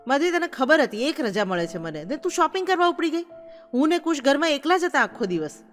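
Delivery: accusing husband. A narrator's reading. Husband scolding wife for going shopping, indignant.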